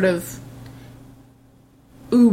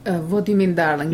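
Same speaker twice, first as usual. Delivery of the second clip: fake Russian accent; 3 semitones lower than usual. Shown as a demonstration. Mock Russian accent spoofing movie actors, hammed-up. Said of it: "do a fake Russian accent".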